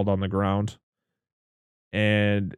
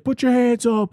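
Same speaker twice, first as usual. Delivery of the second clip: cop shouting; 13 semitones higher, 4 dB louder than usual. Retold or imitated. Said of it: "It's like".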